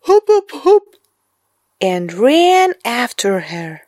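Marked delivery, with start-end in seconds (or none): 0.1-1.0 whispered high dog barks; dog barking. A narrator's reading. Dog joins in, whispered barking. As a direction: bark like a little dog, breathily. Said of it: "Floppy barked".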